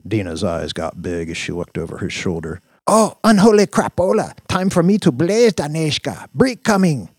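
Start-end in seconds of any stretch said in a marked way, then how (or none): 2.9-7.1 put-on high, loud accented warning; alarmed accented Dina. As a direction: blurt a hurried warning in accent.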